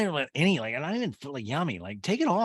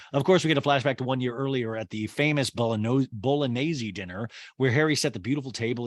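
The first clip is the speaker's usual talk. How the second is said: ordinary talk, nothing marked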